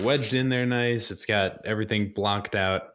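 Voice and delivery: ordinary talk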